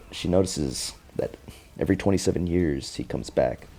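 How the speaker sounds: usual speaking voice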